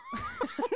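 high squealing burst of laughter; shrieking laugh. Real, not acted. Cracks up over Lyle.

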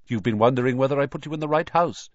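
brisk, fast and smart hat voice; talking hat. Acted. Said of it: "said the hat smartly".

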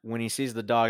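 usual speaking voice

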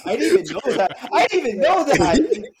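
real laughter through the words; laughing. Real, not acted. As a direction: laugh the protest out. Laughing while protesting.

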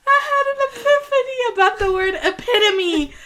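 high, squealing through laughter; laughing. Real, not acted. Co-host admitting her epiphany about a word, laughing. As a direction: barely get it out for laughing.

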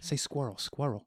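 squirrel said with a mild Boston-ish Long Island lilt; a light attempt at the old Long Island accent; faded Long Island accent. Shown as a demonstration. Kids teasing about his accent, lightly imitated. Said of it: "sounds more like Boston".

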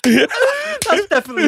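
shrieking with laughter; laughing. Real, not acted. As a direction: blurt it out through laughter. Laughing admission.

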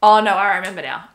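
ordinary talk, nothing marked

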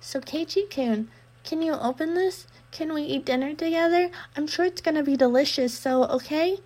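put-on sweet girl coaxing through the door. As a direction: wheedle him to open up.

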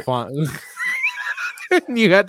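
loud laughing protest; laughing shout. Real, not acted. Laughing shout mid-banter.

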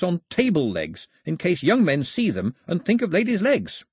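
high, animated explainer; lively Pilou. Acted. Pilou translates the song for Mao, high and eager. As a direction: explain it eagerly, pitched well up.

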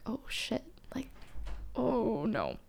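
barely voiced, sinking oh no; whispered dread. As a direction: whisper it like your stomach just dropped. Dread after dad's answer.

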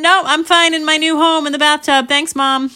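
put-on high, loud child's voice; daughter refusing. Daughter calling from the bathtub to mom, high breezy refusal. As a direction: call back a brisk refusal.

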